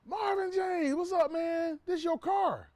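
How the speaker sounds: excited yell of welcome from the car owner; shouted greeting. Retold or imitated. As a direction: call out his name like an old friend. Owner greets him across the lot.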